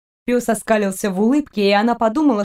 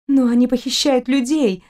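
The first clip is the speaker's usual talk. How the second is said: bewildered; 3 semitones higher than usual. A narrator's reading. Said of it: "растерялась Кристина".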